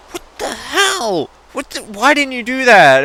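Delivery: high, startled mock outrage; alarmed outburst. Passenger yelling at reckless sister, high alarmed outburst. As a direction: snap at her for braking late. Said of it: "and I'm like".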